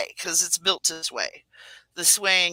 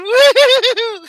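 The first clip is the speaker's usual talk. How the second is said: very high, loud, drawn-out whoop; carefree thrill-ride cry over the alarm; gleeful whoop; 15 dB louder than usual. Acted. Acts carefree reply to safety person.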